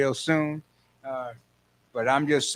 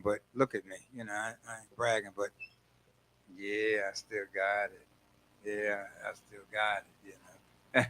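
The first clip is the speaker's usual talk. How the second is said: much softer and lower, a coy boast; sly understated brag; 7 semitones lower, 11 dB quieter than usual. Acted. Host bragging to listeners that he still got it, sly soft brag. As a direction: drop it down low and tease. Playful bragging about himself.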